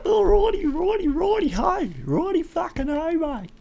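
soft falsetto sing-song; silly high voice. Real, not acted. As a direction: chirp it playfully up high.

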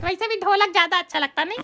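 ordinary delivery

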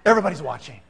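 ordinary delivery, nothing marked

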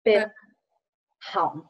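Bin, then Haun, each said apart; syllable-by-syllable model. Shown as a demonstration. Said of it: "the way we say Copenhagen".

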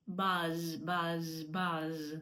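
low "баз" with a long buzzing z; low, repeated "баз" with drawn-out voiced з; bee buzzing. Shown as a demonstration. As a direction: buzz like a bee on the end of "баз". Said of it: "бджілка говорить".

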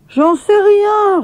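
put-on high, slow girl's voice; girl Lina answering. A narrator's reading. Lina answers Maya about her tooth, slow and high. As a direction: drag it out, a little cheeky. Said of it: "répondit Lina".

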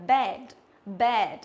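"bed" with a plain, narrow e; true E sound. Shown as a demonstration. Teacher shows the word for furniture, plain-e "bed". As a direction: say "bed" with a pure e vowel. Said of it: "nó âm E thật".

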